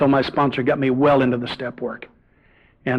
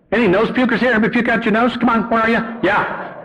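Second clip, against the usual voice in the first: calling out; 9 semitones higher than usual. Real, not acted. Calls out to the audience.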